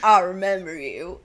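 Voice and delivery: slow, low country accent impression; mock country accent, slow and deep. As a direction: draw it out like a deep country man. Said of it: "in this deep country accent".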